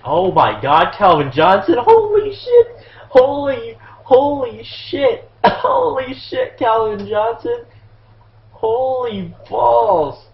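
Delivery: high, loud, drawn-out disbelief; amazed shouting. Real, not acted. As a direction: shout in stunned excitement at a huge play. Amazed by play in game.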